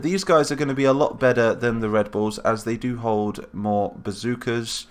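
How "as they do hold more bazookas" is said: normal talking voice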